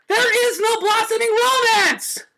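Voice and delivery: very high, loud, slow shout; exasperated shout. A narrator's reading. Twilight cutting Rarity off, high slow shout. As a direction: yell it out, fed up.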